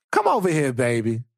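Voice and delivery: put-on slow, soothing auntie comforting a child. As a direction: rock them gently, slow and tender. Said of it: "an auntie one".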